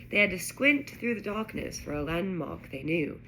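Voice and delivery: ordinary delivery, nothing marked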